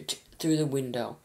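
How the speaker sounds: ordinary delivery, nothing marked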